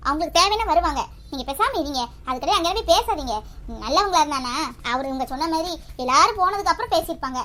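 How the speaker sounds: usual speaking voice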